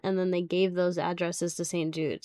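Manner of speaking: normal talking voice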